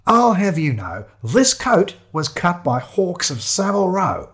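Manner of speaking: high, slow, haughty indignation; affronted. Acted. Shiloh defending Savile Row coat to photographer, affronted. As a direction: draw it out with wounded pride. Shiloh offended at being called homeless.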